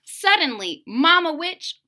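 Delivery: ordinary delivery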